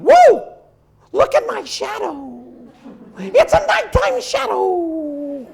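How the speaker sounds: high, wide-eyed whoa at his own shadow; excited horse voice. Acted. Horse marvels at his moonlit shadow, high and thrilled. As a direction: squeal with delight like a young horse.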